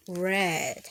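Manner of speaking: plain reading voice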